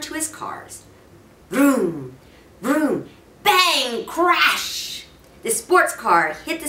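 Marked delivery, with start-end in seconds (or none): 1.5-5.1 mouthed vroom into crash; crash noises. A narrator's reading. Boy crashing his toy cars, playful crash noises. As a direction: smash the toys together with your voice.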